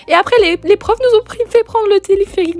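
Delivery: aigu et fort, tendu par la peur; voix aiguë de peur. Real, not acted. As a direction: crier sa peur dans la cabine. Peur dans le téléphérique. (voix paniquée qui monte)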